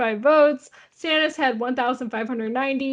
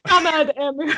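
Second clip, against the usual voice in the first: laughing; 4 semitones higher than usual. Pretend. Joking Russian bot bit.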